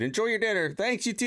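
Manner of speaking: mock server exchange.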